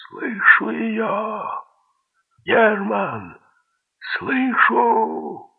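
drawn-out, high-pitched loud shout; yelling. A narrator's reading. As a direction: call it out across a distance, stretching each word. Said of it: "заорал он".

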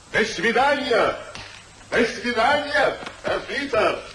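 high, slow farewell called out; calling out goodbye. Acted. Departing friend calls goodbye to Sir Peter. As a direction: call it back over your shoulder.

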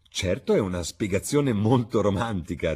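plain reading voice

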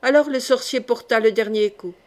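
plain reading voice

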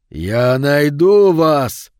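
long, loud drawn-out roar; enraged howl. A narrator's reading. Mutilated figure howling after fleeing mother and boy, helpless rage. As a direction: stretch each word into a furious howl. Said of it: "вопль беспомощной ярости".

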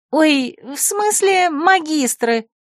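high, slow squeak. Acted. Flustered secretary squeaks a correction. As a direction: peep it out like a mouse. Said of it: "пропищала Лулу".

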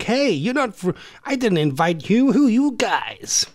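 put-on high-pitched American party girl; American accent. American girl confronting uninvited party guests, mock American accent. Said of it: "just put on American accents".

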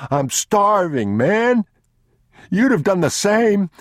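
very high, loud, drawn-out begging; desperate pleading. Acted. Starving thief begs the man, desperately pleading. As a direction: cry out for mercy.

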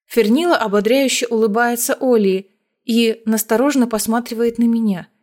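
plain reading voice

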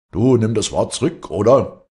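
loud, high, furious ultimatum; raging demand. A narrator's reading. Son rushes at his father's insulter, raging. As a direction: demand it as a shouted threat. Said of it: "wie rasend auf Strominger zugesprungen".

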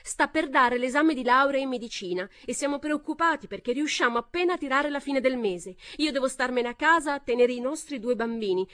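high, loud start. A narrator's reading. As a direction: raise your voice over them. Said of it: "parlava a voce ancora più alta".

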